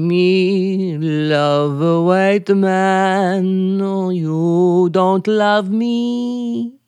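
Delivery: very slow, low languid lament; South Sea native caricature. Pretend. Island native act pleading with husband, slow and languid. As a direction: stretch every word into a sultry drone. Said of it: "as a South Sea Island native".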